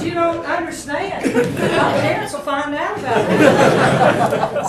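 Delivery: loud laughing protest, slowing at the end; pleading through laughter; laughing plea. Retold or imitated. Student begging the professor not to report, laughing.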